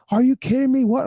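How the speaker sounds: high outraged mock yell; retold screaming. Women in the car screaming at each other, high mock yell. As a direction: shriek it in disbelief. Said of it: "they're screaming at each other".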